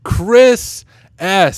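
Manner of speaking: booming winner reveal; game show host. Pretend. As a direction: announce the winner like TV. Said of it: "I should be a game show host".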